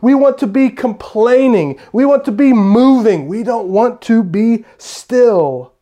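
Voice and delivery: high, loud, pushy voice of the restless heart; a hammed-up restless crowd; mock restless voice. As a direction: act out wanting to be busy. Preacher mimics a restless mindset.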